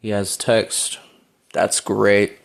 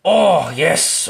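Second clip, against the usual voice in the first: loud, higher burst of triumph; excited celebration; 8 semitones higher, 6 dB louder than usual. Real, not acted. Player cheering a good move in game, excited. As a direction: cheer your own move, voice jumping up.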